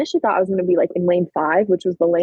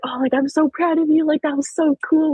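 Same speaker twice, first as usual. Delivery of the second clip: higher-pitched excited praise; imitated gushing; 8 semitones higher than usual. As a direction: gush proudly like a friend after the race. Retold friend gushing after the race.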